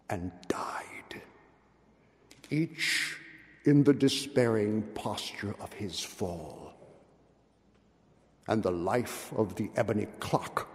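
low, soft, slowing storyteller's close; hushed ominous narration. A narrator's reading. Narrator closing the tale of the Red Death, hushed and grave. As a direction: let the doom settle in a whisper.

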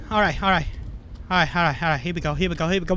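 low, rapid repeated reassurance; nervous muttering. Real, not acted. Player calming down after spotting Santa, nervous low muttering. Nervously getting away in game.

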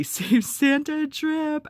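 real laugh on the words; giggling. Real, not acted. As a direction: giggle through the naughty line. Reading a cheeky button.

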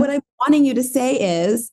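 high-pitched, pleading correction; retold coaxing. Wife tells husband what she needs, high retold coaxing.

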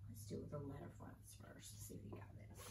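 very soft, whispered aside; near-silent, whispery mumble; hushed aside. Real, not acted. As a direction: murmur it under your breath. Teacher's quiet aside while preparing.

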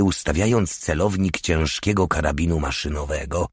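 ordinary delivery, nothing marked

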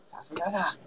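hushed, breathy stage whisper of praise; mock whisper. Praising a teammate after the win, in a mock whisper. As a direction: whisper it like a secret compliment.

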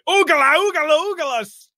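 very high wild battle scream. Acted. The DM narrates the tiefling flying in, very high screaming. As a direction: shriek the nonsense war cry. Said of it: "just screaming".

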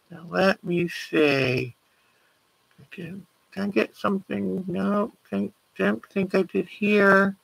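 absent self-talk. Real, not acted. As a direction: think aloud under your breath. Checking files, talking to herself.

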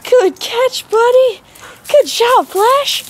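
high, loud squeaky praise; squeaky praising a dog. Real, not acted. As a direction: squeal with delight at the pup. High praise after catch.

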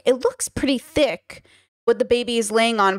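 ordinary delivery

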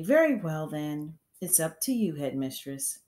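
usual speaking voice

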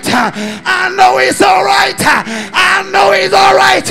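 usual speaking voice

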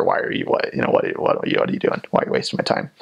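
low, barely voiced mock grumble; half-muttered, put-on irritation; imitated annoyed grumble. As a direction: imitate an impatient local grumbling under their breath. Imitating annoyed Rotterdammers at door.

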